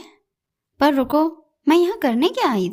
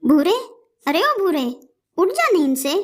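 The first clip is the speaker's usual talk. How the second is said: calling out; 5 semitones higher than usual. Acted. Calling sleeping Bhura to wake.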